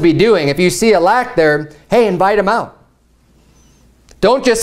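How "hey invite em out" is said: ordinary delivery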